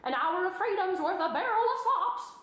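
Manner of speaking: high goose voice. A narrator's reading. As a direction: preach it like a goose. Goose still speaking.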